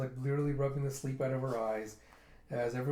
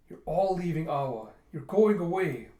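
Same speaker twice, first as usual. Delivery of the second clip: higher, louder hurt protest; upset accusation; 4 semitones higher, 7 dB louder than usual. Retold or imitated. Pirate upset that friends are leaving, raised accusation.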